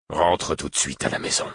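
controlled paternal command, voice held down; stern order. Acted. Father orders his runaway son home, controlled and stern. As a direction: order the child home without shouting.

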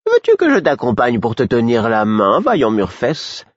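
high, coy mock sweetness; simper, lingering on the last words. A narrator's reading. Fool offering to hold Murfess's hand, simpering. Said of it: "lui dit en minaudant".

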